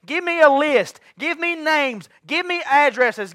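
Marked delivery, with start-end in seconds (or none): none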